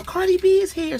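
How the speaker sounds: high, thrilled star-struck gasp; excited fan squeal. Retold or imitated. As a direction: imitate a fan spotting a celebrity. Guard announces [name] excitedly.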